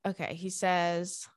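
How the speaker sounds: ordinary talk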